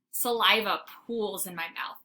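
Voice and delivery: ordinary delivery, nothing marked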